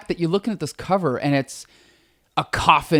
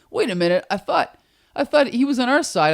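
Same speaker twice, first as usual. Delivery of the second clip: lightly voiced whiny guard; high complaining henchman; 8 semitones higher than usual. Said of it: "the security guards are kinda complaining".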